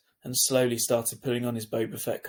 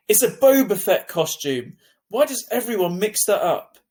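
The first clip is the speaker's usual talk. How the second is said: annoyed; 9 semitones higher, 8 dB louder than usual. Acted. Boy snaps at sister's mistake.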